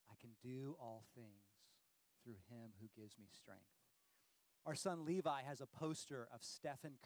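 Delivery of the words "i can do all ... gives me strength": quiet recitation. Shown as a demonstration. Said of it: "like this".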